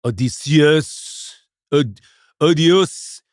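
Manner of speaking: slow, drawn-out Greek "Odysseus"; mock Greek. Contestant guessing how it would be in Greek, overblown mock-Greek accent. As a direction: stretch "Odysseus" grandly as o-dee-SEE-us. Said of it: "but in the Greek, it would be".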